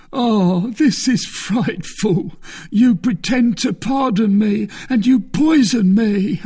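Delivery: high, loud, anguished dying man; agonised accusation. A narrator's reading. Poisoned soldier to the man he blames, agonised accusation.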